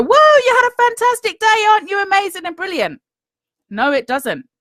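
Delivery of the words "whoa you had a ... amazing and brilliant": high, bright, gushing praise voice; squealing mock praise, much higher and louder; mock cheerful inner voice. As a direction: gush like a flattering cheerleader. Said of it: "wakes you up at three o'clock in the morning and go".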